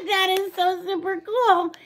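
high-pitched, giggly amazement; laughing delight. Real, not acted. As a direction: gush high, straight out of a laugh. Laughing delight at the candy gift.

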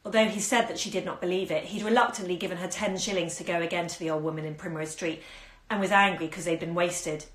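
plain reading voice

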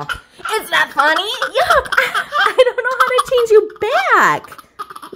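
real laughter; laughing. Real, not acted. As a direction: giggle through the words. Laughing while changing diaper.

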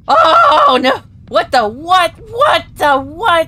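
loud, high cries of alarm; panicked yelling. Real, not acted. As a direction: shout in shocked disbelief. Scare after phone call.

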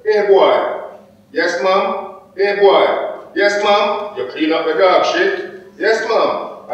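high-pitched order and obedient reply; mistress and servant. Acted. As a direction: alternate sharp command with high, dutiful answer. Acts mistress ordering, servant answering.

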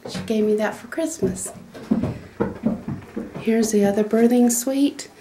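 ordinary talk

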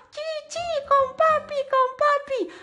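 put-on high squeaky little-girl voice; baby voice. Pup voicing the baby doll, high and squeaky. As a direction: chirp it like a tiny child.

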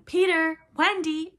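loud calls across the lagoon, high and strong; shouting. A narrator's reading. Boys calling for Peter and Wendy, shouting. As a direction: yell their names out over the water.

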